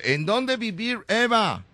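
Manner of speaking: put-on slow, high gringo-accented question; mock English. Host asking Eva where she lives, mock English accent. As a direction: stretch the Spanish into fake American English. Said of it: "otra pregunta en inglés".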